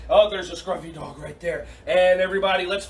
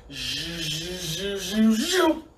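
laser sound effect; much slower than usual. Pretend. Coach firing pretend lasers at kids, rising zap sounds.

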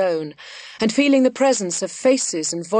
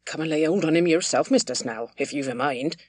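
low, sullen grumble, tailing off softer; rather sullen; 4 semitones lower, 3 dB quieter than usual. A narrator's reading. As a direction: grudgingly push the job back on him. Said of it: "rather sullenly".

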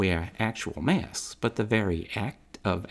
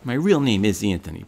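name said in his own Brooklyn New York accent, stress on real; 7 dB louder than usual. Real, not acted. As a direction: drop the act and talk like a Brooklyn guy. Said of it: "a Tony with this accent".

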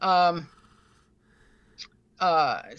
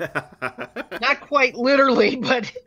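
slow, laughing words, louder; 4 semitones higher, 4 dB louder, slower than usual. Real, not acted. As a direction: chuckle through it. Joking about spirit signs.

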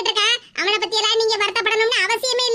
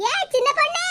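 calling out; 4 semitones higher than usual. Acted. Mother calling out to the young girl, rising. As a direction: call her name higher with a rising end.

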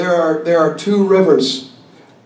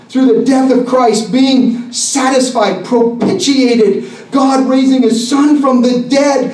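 fervent, higher-pitched sermon with stretched key words; intense preaching; 5 semitones higher than usual. Real, not acted. Preacher calling sinners to the cross, intense and fervent. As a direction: preach the cross with forceful, ringing urgency. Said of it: "I do get a little intense sometimes when I preach".

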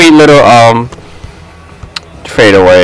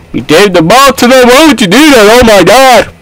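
exasperated put-on voice, higher pitched; John Cena; 6 semitones higher than usual. Player scolding teammate over a pass, mock wrestler voice. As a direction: fake wrestler voice, pitched up in disbelief. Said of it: "that's my fake John Cena voice".

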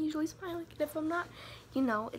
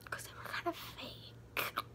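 whispered aside; 4 semitones higher, 10 dB quieter, slower than usual. Real, not acted. Confiding aside.

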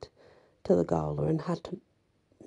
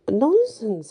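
a sudden loud hoarse croak, higher; 6 semitones higher, 7 dB louder than usual. A narrator's reading. Woman accused of witchcraft croaks a loud denial. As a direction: croak it out after retching. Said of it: "I croaked".

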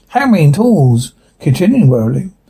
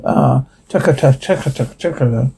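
soft, rhythmic chugging noise; hushed repeated chaka-chaka sound; imitated strange noise; 2 semitones lower, 4 dB quieter, faster than usual. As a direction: imitate an eerie rattle, trailing into near-quiet. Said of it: "make this strange sound".

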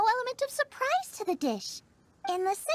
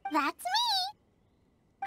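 bright, high cheerful answer; excited; 12 semitones higher than usual. Acted. Chef answers the stall owner, excited and high. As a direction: pop up proudly to claim the name. Xiangling recognised, cheerful reply.

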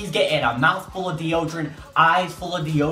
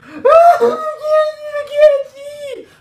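very high, loud mock wail; hammed-up falsetto shriek, drawn out; screaming in pain; 10 dB louder, much slower than usual. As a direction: imitate a blinded kid screaming. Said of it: "like, screaming".

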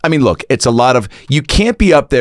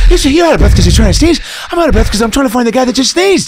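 high, loud, fast, breathless tough guy; Mark Wahlberg impression; 4 dB louder, much faster than usual. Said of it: "Wahlberg is like".

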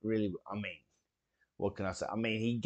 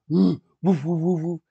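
louder hooting woo calls, higher; imitating kid's hoots; 5 semitones higher, 8 dB louder than usual. Imitating the psycho kid cheering, loud hooting woos. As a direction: hoot like an unhinged kid. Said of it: "the kid's going".

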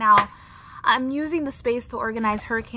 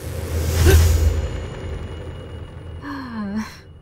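weary sigh; 3 dB louder than usual. Acted. Alone after kids leave.

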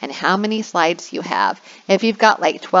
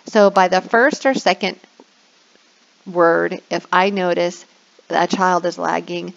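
ordinary delivery

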